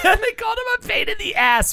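very high, squawked protest; indignant high-pitched yell; incredulous outrage. Real, not acted. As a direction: finish the rant in disbelief. Ranting about child actor's treatment.

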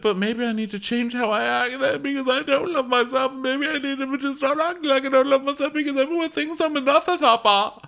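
high, hammed-up tearful rant; exaggerated crying falsetto; mock crying. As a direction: imitate a weepy meltdown, stretching out the last word. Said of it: "so she cries".

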